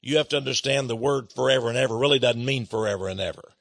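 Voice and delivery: low, soft and fast mock quote of a critic; mock sceptic voice. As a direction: rattle off the critic's excuse quietly and low. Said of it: "I know a lot of people say".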